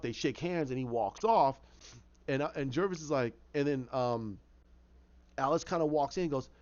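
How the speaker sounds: usual speaking voice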